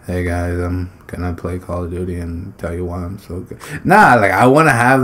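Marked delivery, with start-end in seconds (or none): 0.1-3.6 flat, mumbled, much softer and lower intro; low-energy intro. Pretend. Tired gamer mocks his own dull intro, low, soft and listless. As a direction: sound drained and bored. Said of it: "I don't wanna come and make a video".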